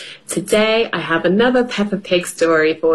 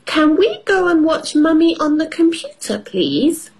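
put-on high child voice asking; little girl voice; 8 semitones higher than usual. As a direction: ask sweetly like a small girl. Peppa voiced in story.

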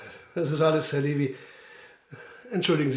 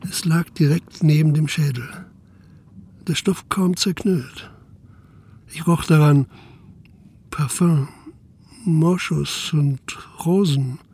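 story narration; 9 dB louder than usual. A narrator's reading. Inspector narrates again.

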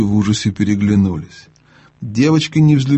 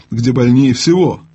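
ordinary delivery, nothing marked

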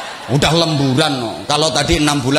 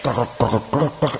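low, softer creaky static; imitated radio crackle; 3 semitones lower, 5 dB quieter, slower than usual. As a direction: mimic a faulty radio crackling. (tiruan bunyi radio kresek-kresek)

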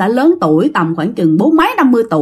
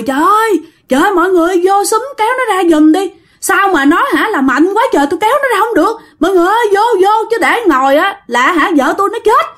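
high, urgent cry to bystanders; higher-pitched, pleading alarm; panicked plea for help; 8 semitones higher, faster than usual. Retold or imitated. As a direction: act out a frantic husband calling for help. Husband calls everyone to help.

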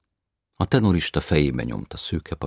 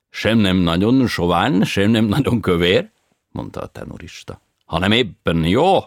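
foreign tenor voice; 6 semitones higher, 11 dB louder than usual. A narrator's reading. Tenor reassures grandmother.

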